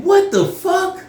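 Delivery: very high, loud, drawn-out disbelief; shocked outburst. Retold or imitated. Storyteller reacting to what the woman revealed, acted shock. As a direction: cry out slowly in acted astonishment.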